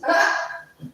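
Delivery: sudden high laugh bursting out; high burst of laughter. Real, not acted. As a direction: crack up, high and loud. Laughing at Kendra story.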